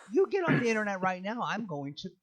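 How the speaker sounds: hammed-up telling-off of the TikTok kids; mock scolding. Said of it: "And I'm like".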